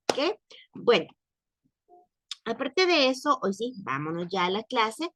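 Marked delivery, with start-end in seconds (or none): none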